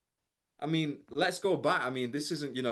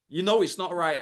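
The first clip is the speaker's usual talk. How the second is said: scolding parent; 5 semitones higher, 6 dB louder than usual. Retold or imitated. Parent tells child off.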